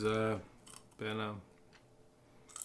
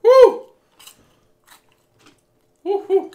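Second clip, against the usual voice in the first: high, loud whooping; excited cheer; 18 dB louder, much slower than usual. Real, not acted.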